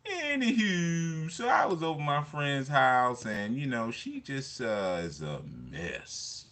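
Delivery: high, slow put-on caller voice; high slow caller impression; imitating a caller. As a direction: mimic the rambling caller, sighing and drawn out. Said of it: "And he'd be talking".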